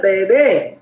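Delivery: ordinary talk, nothing marked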